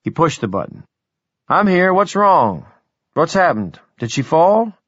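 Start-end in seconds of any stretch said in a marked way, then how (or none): 1.5-4.7 anxious. Acted. Anxious reply over intercom.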